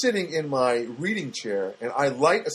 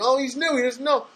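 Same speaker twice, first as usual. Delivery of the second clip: mocking clerk; 12 semitones higher, 3 dB louder than usual. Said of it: "Or they bag my stuff".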